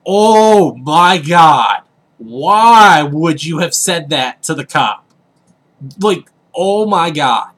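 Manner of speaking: drawn-out, loud, exasperated groan of words; appalled disbelief. Retold or imitated. Nephew reacting to uncle insulting a cop's business, appalled. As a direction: stretch each word out in mortified disbelief.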